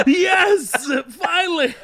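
high, elated cry; mock relief. Guest hearing his name said right at last, elated. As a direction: cry out in comic triumph.

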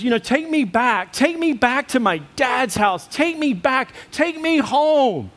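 pleading wife. Retold or imitated. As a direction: beg like a hurt young wife wanting her dad.

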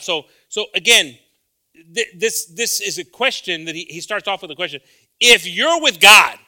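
loud, high shouted scripture; full-force preaching. Acted.

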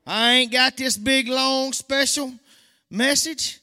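high, loud, slow-stretched preaching; humble preacher insisting loudly; emphatic preaching. Real, not acted. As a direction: lean on each word of the claim. Preaching at full force.

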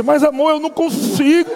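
high, laughing, helpless; laughing plea. Retold or imitated. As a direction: plead through laughter. Husband laughing helplessly in church.

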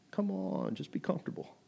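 soft, breathy lure into comfort; tempting whisper. Acted. Tempter coaxing churchgoers to stay comfy, whispered.

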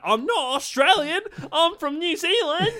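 high, loud imitation of an outraged teacher; hammed-up indignant yelling; mock outraged shout. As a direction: shoot up high, losing it in mimicry. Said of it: "she would lose her shit".

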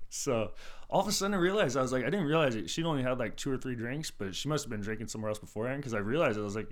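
usual speaking voice

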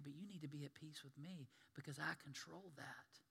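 soft whispered reassurance; Jesus answering the frightened believer, soft and reassuring; gentle whisper. Retold or imitated. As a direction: soothe a frightened child.

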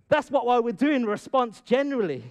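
ordinary talk

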